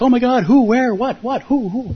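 high, flustered retold panic; startled monks. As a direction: sputter it like monks caught off guard. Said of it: "And they're like".

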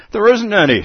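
March Hare answering, high and loud. Acted. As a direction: snap it back high as the Hare. March Hare voiced in story.

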